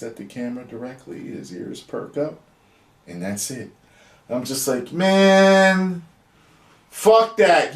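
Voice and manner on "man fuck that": higher, louder exasperated cry; emotional outburst. Real, not acted. Fan reacting to the ending, higher exasperated outburst. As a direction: blurt it out, overwhelmed. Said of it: "I'm emotional, man".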